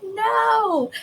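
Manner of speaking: high, laughing cry of No; shocked laughing shout; mock shout. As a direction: yelp No at the client in disbelief. Coach shouting no at client.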